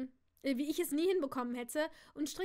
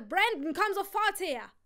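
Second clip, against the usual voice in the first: loud, high summons; urgent shout; 6 semitones higher, 9 dB louder than usual. Acted. Father calls Brandon over his shoulder, shouting urgently. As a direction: yell over your shoulder for him to come. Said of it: "rief so laut er konnte".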